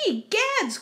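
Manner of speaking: high-pitched shocked mother bear; crying out. Acted. Mama Bear finding her bed disturbed, high shocked cry. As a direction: exclaim it high, aghast. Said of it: "cried Mama Bear".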